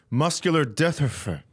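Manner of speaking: "Muscular dystrophy" with the last word mangled into "dethurfuh"; garbled charity name. Retold or imitated. Cashier asking for a donation, "dystrophy" slurred into "dethurfuh". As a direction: imitate fumbling the end of "dystrophy". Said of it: "And it's getting worse".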